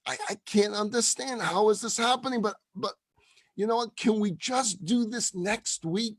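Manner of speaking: high, flustered and stammering; disbelieving. Retold or imitated. Baffled woman on the phone to the rabbi, disbelieving. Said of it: "She can't believe this is happening".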